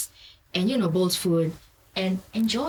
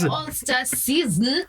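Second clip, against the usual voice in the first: slow, higher theatrical proclamation; hammy stage actor; 6 semitones higher, slower than usual. Acted. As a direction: stretch it out for the crowd. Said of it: "don't mind the theatrics".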